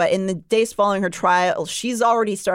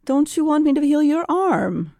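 higher, sly, coaxing offer; taunting Rhysand; 7 semitones higher than usual. Acted. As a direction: coax her like a sly fae. Reading Rhysand's line.

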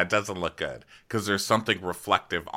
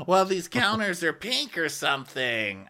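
mock Denise; 9 semitones higher than usual. Mocking Denise on counters.

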